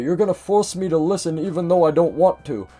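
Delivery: lightly hammed-up protest; mock exasperated grandfather. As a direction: imitate a put-upon grandfather complaining loudly. Imitates grandfather complaining about listening.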